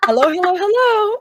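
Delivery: high, bright sing-song hellos. Retold or imitated. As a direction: sweep into the room announcing yourself. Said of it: "[name] ... he goes".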